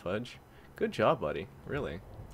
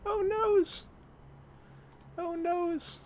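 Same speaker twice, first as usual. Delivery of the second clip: squeaky high "oh noes"; mock dismay; much slower than usual. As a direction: whine it in cartoon despair. Mock dismay after death.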